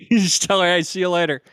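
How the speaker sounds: high, loud laughing. Real, not acted. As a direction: laugh through the line. GM mocks players leaving, laughing.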